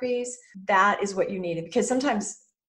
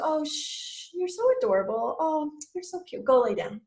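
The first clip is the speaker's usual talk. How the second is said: cooing to a dog; 6 semitones higher than usual. Pretend. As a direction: coo at a cute barking puppy. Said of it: "And you just go".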